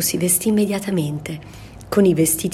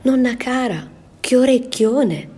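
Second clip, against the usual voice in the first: put-on little girl's voice; 7 semitones higher, 4 dB louder than usual. Girl exclaiming at the grandmother's big ears. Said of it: "poi dice".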